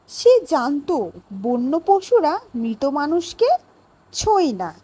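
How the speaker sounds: plain reading voice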